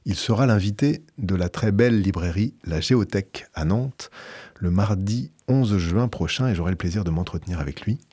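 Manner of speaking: usual speaking voice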